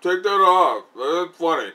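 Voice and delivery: scolding teacher. Retold or imitated. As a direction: scold the kid like an exasperated teacher. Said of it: "the teacher's like".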